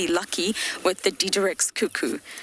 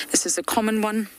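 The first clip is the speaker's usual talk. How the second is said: usual speaking voice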